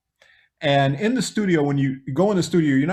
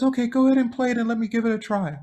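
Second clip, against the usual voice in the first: Michael impression; 9 semitones higher, faster than usual. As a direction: lift the voice into a gentle high Michael. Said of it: "He says".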